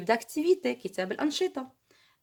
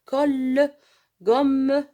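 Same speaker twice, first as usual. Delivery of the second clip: Colle and Gomme loud, the ll and mm held; stressed double consonants; 2 semitones higher, 7 dB louder, much slower than usual. Shown as a demonstration. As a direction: punch each double letter. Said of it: "نشدد على الحرف". (تشديد الحرف المضعف في Colle و Gomme)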